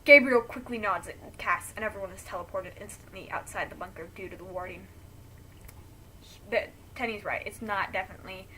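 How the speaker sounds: ordinary delivery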